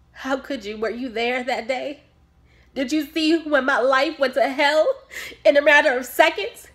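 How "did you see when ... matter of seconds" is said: loud, raised-pitch outburst at the doctor; angry confrontation. Acted. Bereaved mother challenging her doctor about that day, angry. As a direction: demand answers, voice climbing with anguish.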